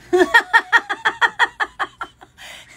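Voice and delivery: loud burst of high laughter; laughing. Real, not acted. Opening the gift, bursting out laughing. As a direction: cracks up at the sign.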